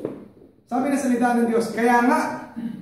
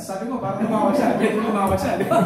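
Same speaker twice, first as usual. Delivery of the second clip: ordinary delivery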